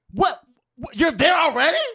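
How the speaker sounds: high, loud disbelief; mock shock. Woman learning her date is already there, acted alarm. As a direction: react as if caught completely off guard.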